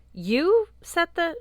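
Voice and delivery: high, drawn-out disbelief; stunned, cut off mid-question; shocked. Acted. Shocked interruption.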